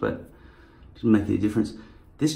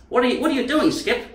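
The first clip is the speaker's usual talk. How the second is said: pet talk; 3 dB louder than usual. Acted. Addressing dog Skip, high voice.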